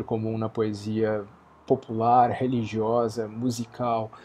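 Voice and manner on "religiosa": ordinary delivery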